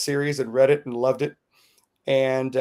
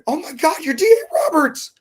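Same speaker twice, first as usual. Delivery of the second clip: much higher, louder starstruck shriek; fan scream; 4 dB louder than usual. Retold or imitated. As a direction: scream it like meeting your hero. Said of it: "literally I screamed".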